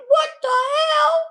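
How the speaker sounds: slow, very high cry of "what the hell"; tearful outcry. Retold or imitated. Learning the dose was eighty milligrams, crying out high. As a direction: wail it in shocked dismay. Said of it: "I'm like".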